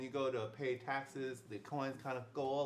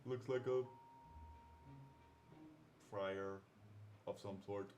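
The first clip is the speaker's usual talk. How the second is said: [name]; 4 semitones lower, 3 dB quieter than usual. Acted. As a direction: stretch each word out in a put-on voice.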